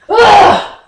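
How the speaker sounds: loud, high burst of frustration; exasperated yell. Acted. Son fed up with Mom's secrecy, exasperated yell. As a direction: explode with a frustrated cry.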